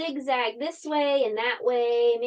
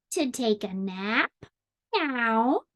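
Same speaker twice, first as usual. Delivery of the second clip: cat voice; slower than usual. Acted. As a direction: ask it with a feline upswing. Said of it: "cried the cat".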